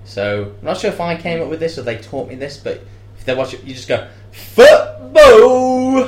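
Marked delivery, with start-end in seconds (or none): 4.3-6.1 very loud, high football bellow; hammed-up lads' roar, drawn out; shouted fan cheer. Shown as a demonstration. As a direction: shout it like a faked sports fan. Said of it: "shouting that is a bit of a red light".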